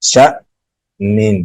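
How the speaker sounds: "شأنن" cut abruptly at the hamza, then resumed; slow recitation, jolting stop inside "شأنن". Shown as a demonstration. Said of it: "جھٹکا لگا کے پڑھا جاتا ہے".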